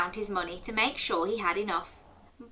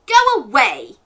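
loud, mock-angry send-off; storybook grump, much louder; cross dismissal; 3 semitones higher, 12 dB louder than usual. A narrator's reading. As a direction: shoo the visitor off loudly. Neighbour sends wolf away.

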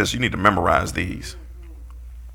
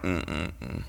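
soft, muffled hum of disapproval; disapproving congregation; 4 semitones lower, 12 dB quieter than usual. Retold or imitated. Preacher imitates the congregation's quiet disapproving hum. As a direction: hum it like a doubtful listener. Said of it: "y'all like".

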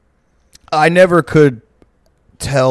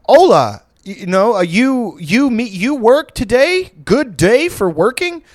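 high, very slow talking down; mock condescending pidgin; 8 semitones higher, slower than usual. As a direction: patronise the worker, high and slow. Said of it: "it's like fucking".

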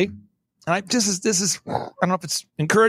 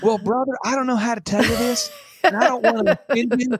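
lightly put-on, higher churchy voice, half laughing; mock Christianese pastor; 3 semitones higher than usual. Guest mimics a pastor softening bad news, mildly mocking churchy voice. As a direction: imitate a pastor hedging politely, a touch higher. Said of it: "But people will say".

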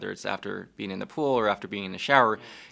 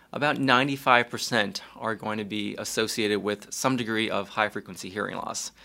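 ordinary talk, nothing marked

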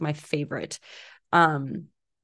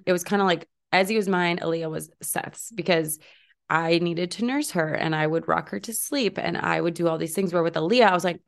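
normal talking voice

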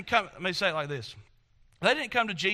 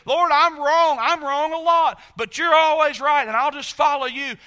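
fervent mock prayer; 5 semitones higher, 10 dB louder than usual. Voiced prayer of repentance.

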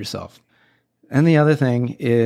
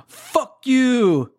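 imitated high-pitched New Yorker heckle; mock yell at a stranger, pitched way up; 12 semitones higher than usual. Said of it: "somebody just yells at him in New Yorker style".

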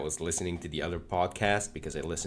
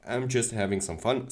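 ordinary talk

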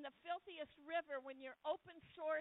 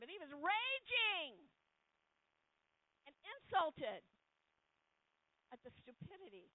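preaching loud; 4 semitones higher, 7 dB louder than usual. Acted. Preacher building on Naaman's anger.

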